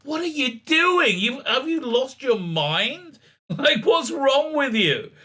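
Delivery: high, slow patronizing scolding; mock an obnoxious telling-off, drawn out; bad David Tennant. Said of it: "she's doing a terrible David Tennant impersonation".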